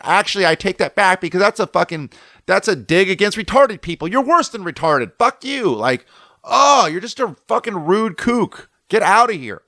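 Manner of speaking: high, indignant re-enacted tirade; pissed. Surfer scolding the other surfer as onlookers watch, pissed. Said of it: "And I'm, like, pissed".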